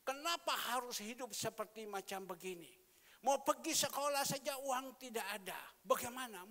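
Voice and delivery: loud, high imitation of angry grumbling; grumbling outburst. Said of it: "dia bersungut-sungut".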